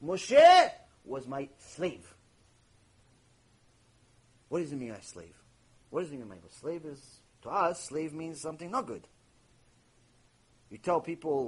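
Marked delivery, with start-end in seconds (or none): none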